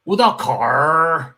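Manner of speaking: put-on slow, loud Wudaokou in a thick Beijing accent with r-colouring. Telling a Beijing cab driver where to go, in thick Beijing accent. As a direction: drag out Wudaokou the Beijing way, like pirate talk. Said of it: "real pirate talk is the Beijing accent".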